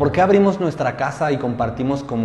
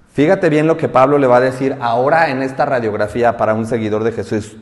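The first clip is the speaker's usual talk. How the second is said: ordinary talk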